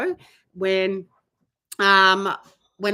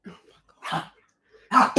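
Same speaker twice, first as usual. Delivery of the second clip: normal talking voice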